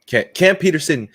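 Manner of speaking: loud, high, thrilled naming; hyped shout-out for a friend; excited shout-out. Real, not acted. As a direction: call his name out with delight.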